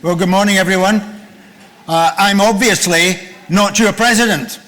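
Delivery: normal talking voice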